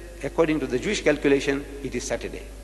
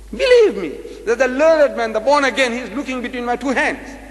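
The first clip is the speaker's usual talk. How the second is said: excited telling; 6 semitones higher, 4 dB louder than usual. Real, not acted. Excited storytelling of the debate.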